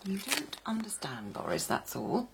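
hushed protest. Acted. Mother hushed, defending her lover.

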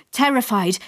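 ordinary delivery, nothing marked

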